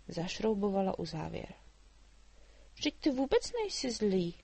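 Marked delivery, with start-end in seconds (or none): 2.7-4.3 put-on much higher, lively girl's voice; a chatty girl teasing the dragon; little girl Mája. A narrator's reading. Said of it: "řekla Ludvíkovi". (vysoký živý dívčí hlas)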